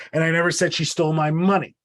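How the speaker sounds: money stressed with a falling tune. Shown as a demonstration. Teacher showing the class stress on money. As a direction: land the weight on the word money. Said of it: "I'll prove it to you".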